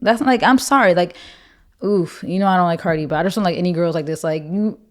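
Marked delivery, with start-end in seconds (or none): none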